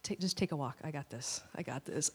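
hushed dad taking over; calm quiet husband. Retold or imitated. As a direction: settle her down gently. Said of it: "He's like".

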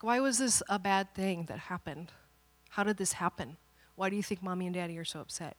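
soft, patient questions; gentle father. Retold or imitated. Father talking daughter through what happened, gently.

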